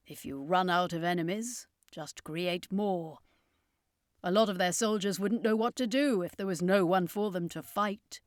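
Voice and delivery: put-on grim old showman; circus master, bitter. A narrator's reading. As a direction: say it with dark irony.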